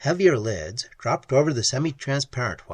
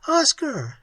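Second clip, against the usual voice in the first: cry out; 12 semitones higher than usual. A narrator's reading. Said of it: "she cried".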